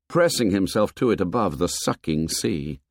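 ordinary delivery, nothing marked

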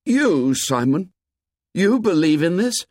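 high, incredulous questioning; astonished boy challenging a friend; amazed. A narrator's reading. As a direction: jump up in pitch in disbelief. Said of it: "Ralph stood up in amazement".

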